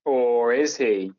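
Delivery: slow, drawn-out dramatic echo; mock ominous echo. Echoing a friend's teasing doubt, slowly and ominously. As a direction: spin it out like a mystery twist.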